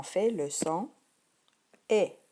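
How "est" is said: usual speaking voice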